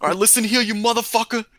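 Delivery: high, squeaky mock threat; tough guy voice. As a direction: threaten like a cartoon thug. Friends trading tough guy voices.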